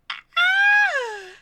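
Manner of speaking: extremely high, loud shriek; real scream of overjoyed shock; excited scream. Real, not acted. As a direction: squeal at the top of the range. Screams at a big donation.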